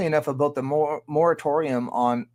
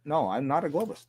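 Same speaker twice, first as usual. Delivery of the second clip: ordinary talk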